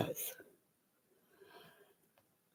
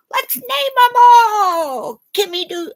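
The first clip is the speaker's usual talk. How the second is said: excited shout; 8 semitones higher, 5 dB louder than usual. Real, not acted. Excitedly naming her chickens.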